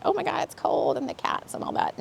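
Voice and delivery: breathy panicked mock quote; freaking-out person. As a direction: whisper it like someone fretting. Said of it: "anybody that's freaking out, like".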